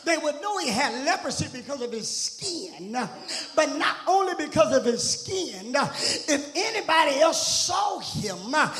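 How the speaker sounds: rhythmic preaching with punched, stretched key words; fervent church sermon cadence. Real, not acted. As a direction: preach it hard in bursts to the congregation. Preaches at full force.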